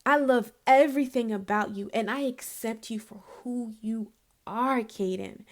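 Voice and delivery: soft, low and hushed; tender. Retold or imitated. Aunt to nephew at night, soft tender reassurance. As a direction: murmur it like a lullaby to him. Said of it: "I was like".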